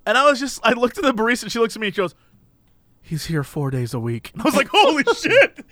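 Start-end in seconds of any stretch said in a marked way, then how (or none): 3.0-4.3 soft, low-pitched deadpan; low weary aside. Retold or imitated. Barista confiding about a regular troublemaker, low and resigned. 4.7-5.7 high-pitched retold shock through laughter; laughing disbelief. Customer hearing the man comes often, laughing in disbelief.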